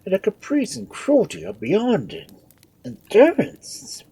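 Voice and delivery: high, loud lament of a doctor; aggrieved councillor's moan; groaning complaint. A narrator's reading. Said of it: "groans Doctor Hardman".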